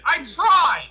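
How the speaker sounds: high, loud plea of innocence; emphatic, high-pitched self-defense; defensive protest. Real, not acted. Defends himself after friend's jab.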